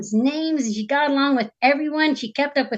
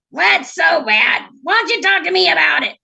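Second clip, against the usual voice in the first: loud frog character voice, raised pitch; cheeky frog coaxing at full volume; brash talking frog; 6 semitones higher, 5 dB louder than usual. Acted. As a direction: call out pushily as the frog. Unseen frog calls to princess again.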